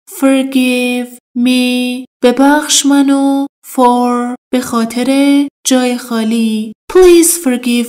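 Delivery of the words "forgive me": slow drill. Shown as a demonstration. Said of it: "لطفاً".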